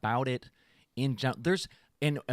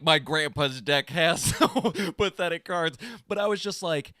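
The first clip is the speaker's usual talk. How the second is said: ordinary talk, nothing marked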